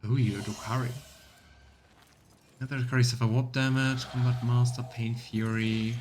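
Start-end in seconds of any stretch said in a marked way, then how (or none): none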